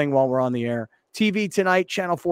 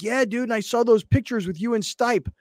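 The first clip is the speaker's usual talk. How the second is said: higher-pitched mimicry of a colleague; imitating Lawhead; 8 semitones higher than usual. Host retelling colleague's remark about the photos, high mimicry. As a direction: retell it as the eager co-worker, pitched up. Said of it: "and Lawhead's like".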